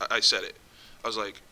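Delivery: ordinary talk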